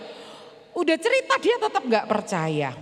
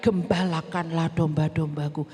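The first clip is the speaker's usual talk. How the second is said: a touch lower, gentle question; slightly deep gentle Jesus; lightly voiced Jesus; 9 semitones lower than usual. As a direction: ask earnestly as the teacher. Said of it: "tanya sama temanku Petrus".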